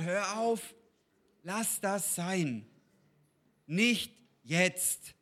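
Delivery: a hushed, insistent warning from within; inner voice of God's Spirit. Retold or imitated. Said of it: "der Geist Gottes in mir schon hundertmal geschrien hat".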